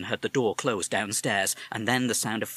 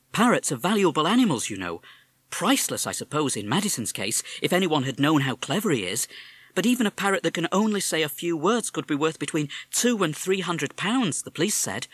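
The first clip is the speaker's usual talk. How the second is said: mother; 7 semitones higher, 4 dB louder than usual. Acted. Mother explains.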